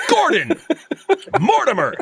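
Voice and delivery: high, loud triumphant calling. Retold or imitated. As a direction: yell the names like a big win.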